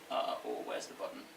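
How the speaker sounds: muttered under the breath; whispered aside. Real, not acted. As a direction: whisper it to yourself. Aside while hunting for slide button.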